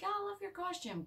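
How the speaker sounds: put-on high, soft chirpy girl voice; chirpy girlfriend. Girlfriend gushing at the door, high and soft. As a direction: gush sweetly over the costume. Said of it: "she's like".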